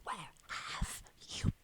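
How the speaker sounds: unvoiced furious whisper; angry whisper. Angry friend scolding Jill, as quiet as possible. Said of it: "as quiet as he can be".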